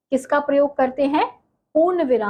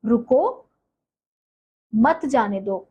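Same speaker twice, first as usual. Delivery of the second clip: a long break after रुको, then मत जाने दो as one phrase; pause after रुको; slower than usual. Shown as a demonstration. Teacher gives pupils an example of punctuation, long pause after रुको. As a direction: hold a clear silence after "रुको" before "मत जाने दो". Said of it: "जैसे".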